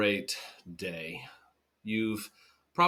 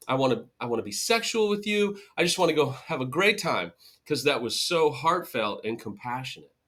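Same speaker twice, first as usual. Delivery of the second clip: higher gushing mockery after a gasp; sarcastic mock swoon; 3 semitones higher than usual. Hurt partner mocking a lazy apology, gushing sarcastically. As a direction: overplay being moved, dripping sarcasm.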